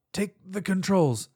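coughing out the words; strained, slow, through a cough. Acted. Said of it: "she coughed violently".

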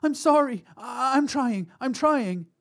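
very high, panicked, halting; desperate cry. A narrator's reading. Mother hushing child to robber, panicked cry. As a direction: apologize frantically, near tears. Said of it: "the mother cried".

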